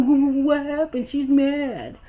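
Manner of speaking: put-on a flustered boyfriend rushing in; frantic Devon. Devon voiced frantic again.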